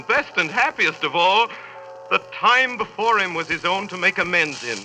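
rising, joyous narration; high, elated storytelling; excited. A narrator's reading. Narration turns joyful.

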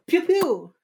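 high, loud playful pew pew; mouth gunshot noise. Retold or imitated. Retelling how Elle shot him, playful high gun noises. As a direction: fire off cartoon gunshots with your mouth.

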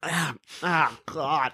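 mock tasting something foul, softly; imitated disgust. Imitating people tasting a fart, quietly disgusted. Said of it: "they're just like".

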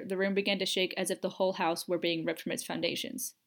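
plain reading voice

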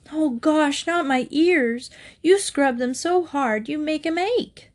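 put-on high-pitched childish protest; high, plaintive kid voice; whining boy. A narrator's reading. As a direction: plead and complain like a scrubbed little boy. Boy protests at being scrubbed.

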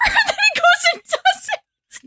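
high, slow laugh-through line; squealing laugh. Real, not acted. Punchline to friends, laughing high and slow. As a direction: let it break into laughter.

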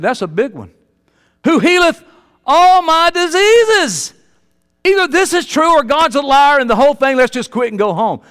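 loud, high scripture proclamation; fervent preaching. Acted. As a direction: stretch out all my diseases.

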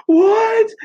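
high, loud shocked "What?"; shocked shout. Retold or imitated. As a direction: shout it in disbelief. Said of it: "everybody was like".

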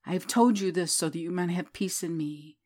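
ordinary talk

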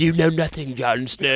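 attempted character accent, playfully imitated; a fan's loose accent impression; Ygritte accent. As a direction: roughly imitate her accent from the show. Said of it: "her accent".

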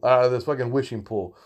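ordinary talk, nothing marked

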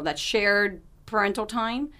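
ordinary delivery

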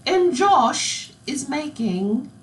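put-on high, very slow regal British accent; Queen-like British accent. Baking show host introducing a baker, queenly British accent. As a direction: draw it out in a mock royal British accent. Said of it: "I sound like the Queen".

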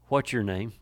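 soft, low, caring question; hushed compassion for a tormented man; gentle Jesus. Acted. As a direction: ask it tenderly and quietly. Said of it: "Jesus cared".

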